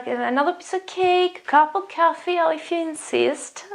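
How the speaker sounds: high-pitched, courteous exchange; elegant, high social politeness; polite offer and acceptance. Shown as a demonstration. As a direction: offer politely, then graciously give in. Role-plays polite offer and acceptance.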